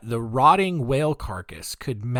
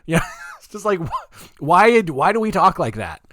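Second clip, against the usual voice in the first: laughing; 7 semitones higher, 6 dB louder than usual. Real, not acted. Laughing after the impression.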